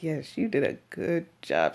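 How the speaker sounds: mock-reassuring, back down in pitch; humouring reply. Patient to eager helper after painful shot, humouring praise. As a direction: humour her with put-on praise. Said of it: "I'm like".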